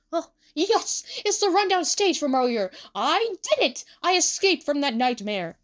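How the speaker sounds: triumphant relief; Tsukasa. A narrator's reading. Tsukasa realizes he escaped the nightmare, triumphant.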